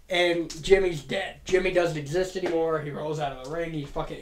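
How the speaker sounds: usual speaking voice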